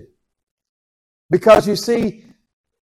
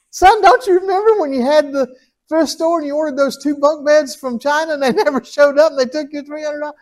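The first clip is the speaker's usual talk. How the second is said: a lightly voiced father, higher, teasing; God reminding his son of the lost money; a hint of a chiding dad; God as fond father; 11 semitones higher than usual.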